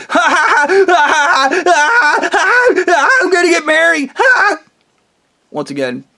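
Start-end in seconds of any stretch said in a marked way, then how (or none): 0.1-4.6 very high, loud villain laugh; gloating mock cackle. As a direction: cackle wildly in triumph, shrieking through it. Acted gloating cackle as Blondie.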